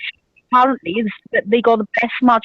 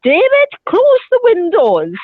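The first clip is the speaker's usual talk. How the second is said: higher, louder, slow call to David; mock shout; 8 semitones higher, 3 dB louder than usual. Girlfriend calling up to the window, mock shouting. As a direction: yell at him to shut the window.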